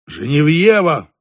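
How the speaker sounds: loud, drawn-out name. A narrator's reading. Hero at the mirror says his beloved's name out loud. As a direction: proclaim the name slowly and loudly. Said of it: "громко произнёс он".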